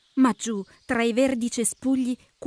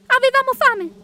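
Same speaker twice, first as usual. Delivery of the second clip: very high, loud bee voice; tiny high-pitched insect answer; bee characters; 14 semitones higher, 7 dB louder than usual. Acted. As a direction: pipe it up sharply as little bees.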